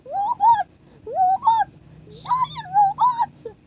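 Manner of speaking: very high, loud screaming alarm; panicked yelling. A narrator's reading. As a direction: shriek it as you run.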